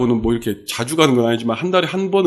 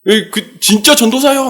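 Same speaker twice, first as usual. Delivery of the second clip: disbelief; 10 semitones higher, 5 dB louder than usual. Retold or imitated. Drunk man doubting the preacher.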